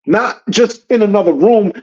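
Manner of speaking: usual speaking voice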